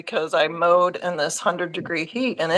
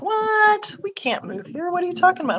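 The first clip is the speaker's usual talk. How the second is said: high, disbelieving; startled husband; 11 semitones higher than usual. Retold or imitated. Husband told they should move here, disbelieving. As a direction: shoot it back in disbelief.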